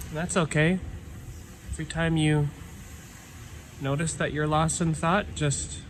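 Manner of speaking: calm meditation guide with long pauses; guided meditation. Real, not acted. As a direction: ease listeners inward, leaving silence between phrases. Leads a breathing meditation.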